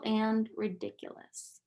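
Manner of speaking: plain reading voice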